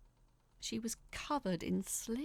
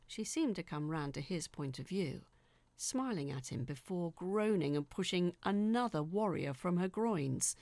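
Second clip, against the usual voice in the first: usual speaking voice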